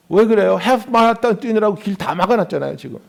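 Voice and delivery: normal talking voice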